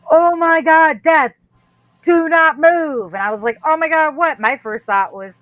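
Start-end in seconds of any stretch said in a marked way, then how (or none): 0.1-3.1 loud, high mock alarm, urgent; freaked out. Man spots poison ivy round her, freaked-out warning. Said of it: "'Cause he just freaked". 3.6-4.4 high, loud startled question. Retold or imitated. Answering a friend's sudden warning, startled.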